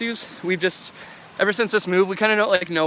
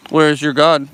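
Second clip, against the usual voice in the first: loud taunt at normal pitch; mock menacing taunt; 9 dB louder, slower than usual. Streamer taunting chat in jest, loud mock menace. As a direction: demand it loudly in mock menace.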